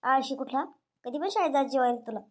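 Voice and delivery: ordinary talk, nothing marked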